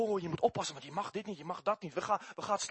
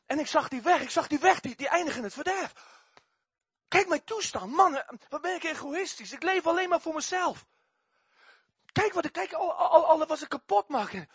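agitated recollection; 9 semitones higher, 4 dB louder than usual. Retold or imitated. Testimony of seeing his own ruin.

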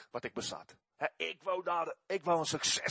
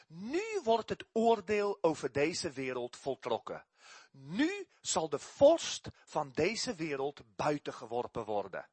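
solemn scripture reading; 4 semitones lower, slower than usual. Acted. Reads scripture aloud.

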